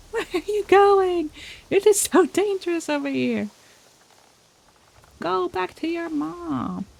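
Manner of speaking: high desperate pleading, ends in a cry for help; frantic begging. Real, not acted. Chasing cat again, can't stop it.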